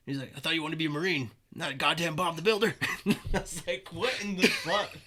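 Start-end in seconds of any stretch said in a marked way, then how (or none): 0.4-2.7 drill instructor scold. Retold or imitated. Drill instructor mocks recruit. 3.9-5.0 high, loud and slow through laughter; laughing disbelief. Retold or imitated. Recruit cracking up at the drill instructor's joke, laughing.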